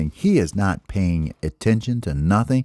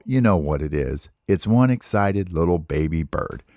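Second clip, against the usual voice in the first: ordinary delivery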